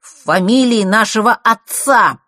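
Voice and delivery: slow, loud, stubborn emphasis; obtuse insistence. A narrator's reading. Nort presses the point about father's name, stubbornly. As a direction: drive each word home doggedly. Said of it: "с какой-то тупой настойчивостью".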